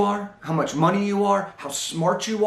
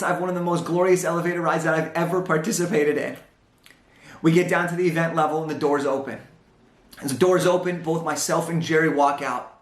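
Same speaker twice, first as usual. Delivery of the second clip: ordinary talk, nothing marked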